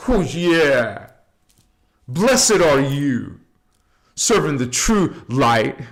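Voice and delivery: booming channeled proclamation. Acted. A channeled being blessing the viewer in a booming voice. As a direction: proclaim it like a spirit blessing the listener.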